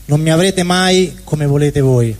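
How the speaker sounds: ordinary talk, nothing marked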